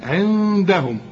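عندهم with the noon held as a hum; nasal ghunna. Shown as a demonstration. Teacher shows the ghunna just named, held nasal noon. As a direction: hum through the ن of عندهم before the d. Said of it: "غن هنا".

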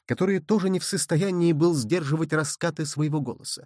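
ordinary delivery

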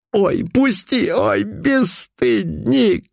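high, slow squealing cry; woman's shriek. Acted. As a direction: squeal like a woman being grabbed. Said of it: "вскрикивала женщина".

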